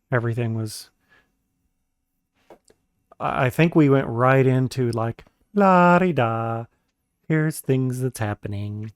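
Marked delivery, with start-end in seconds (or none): 5.5-8.9 slow, carefree sing-song; breezy mock narration. Co-author mocking their book's aimless opening, slow and breezy.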